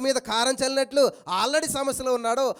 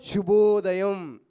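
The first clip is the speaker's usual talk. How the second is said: drawn-out greeting; 3 semitones lower, 4 dB louder, much slower than usual. Shown as a demonstration. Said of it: "పొద్దున్నే మనం ఏమంటాం".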